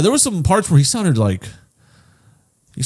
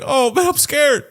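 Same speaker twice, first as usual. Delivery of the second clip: high, slow mock-scared voice; acted fear; 11 semitones higher than usual. Reviewer voicing the dog actor, high mock fear. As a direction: imitate a scared dog, pitched up. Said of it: "This dude's like".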